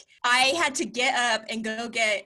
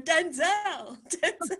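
higher, playful pretend call; mock calling a dog; 6 semitones higher, 3 dB quieter, slower than usual. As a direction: call the dog in a silly impression.

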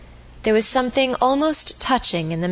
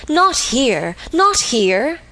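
vehement plea; 4 semitones higher, slower than usual. A narrator's reading. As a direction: hold her back with urgency. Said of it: "he cried vehemently".

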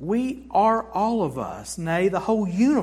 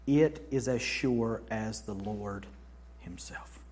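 whisper; 8 semitones lower, 7 dB quieter, slower than usual. Real, not acted. Awed close of an assurance.

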